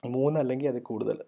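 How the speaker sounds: ordinary talk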